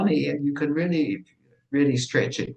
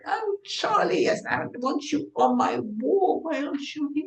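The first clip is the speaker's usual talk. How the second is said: put-on a much higher, sing-song gush over an old photo; gushing high greeting; 12 semitones higher than usual. Teasing a friend about his photo on her kitchen wall, in a gushing high voice. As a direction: coo at him like a delighted fan.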